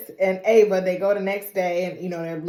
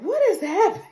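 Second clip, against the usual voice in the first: high baffled mock; 11 semitones higher, faster than usual. Marty baffled at arrest.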